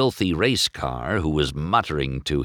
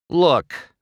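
sharp, loud irritated look; annoyed race car; irritable; 9 semitones higher, 4 dB louder than usual. Acted. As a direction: snap at the shopkeeper. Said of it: "he said irritably".

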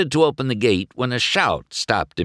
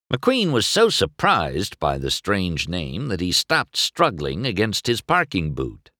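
plain reading voice

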